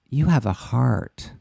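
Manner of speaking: slow, eerie, emptied-out imitation; hollow soulless voice. Mimicking a broken friend who says you have a heart, slow and hollow. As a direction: say it slowly like a soul with its heart sucked out. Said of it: "she says".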